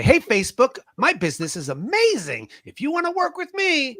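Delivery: high-pitched, hyped-up sales pitch; fake on-camera enthusiasm. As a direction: put on a show for the camera. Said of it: "turn the cameras on and we say".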